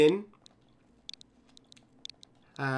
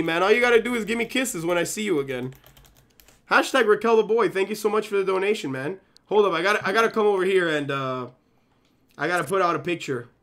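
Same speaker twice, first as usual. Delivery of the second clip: ordinary talk, nothing marked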